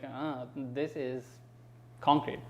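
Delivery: mildly acted letdown, trailing into the realisation; underwhelmed reaction. Recalling landing over in the daytime, mildly underwhelmed. Acting out underwhelmed arrival in Dallas.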